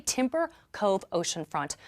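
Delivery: normal talking voice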